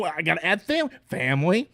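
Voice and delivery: a parody of the tough-guy family speech; mock Dom voice. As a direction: ham up a gruff Dom droning on about family. Mocking the franchise's family theme.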